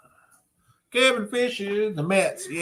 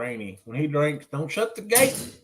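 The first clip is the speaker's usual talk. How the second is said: ordinary talk, nothing marked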